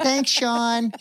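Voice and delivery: high, nasal-free Wisconsin thanks; bright upper Midwest Wisconsin accent. Shown as a demonstration. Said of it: "that's my Wisconsin accent".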